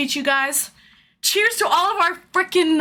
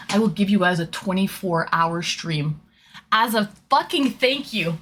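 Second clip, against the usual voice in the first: ordinary talk, nothing marked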